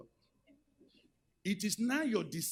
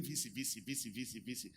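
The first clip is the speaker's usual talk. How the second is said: fading, softer repetition of V C; mock repetition; 5 semitones lower, 9 dB quieter than usual. Professor keeps answering the pastor, fading repeat. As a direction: let the V C trail away quieter each time. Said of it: "He kept saying".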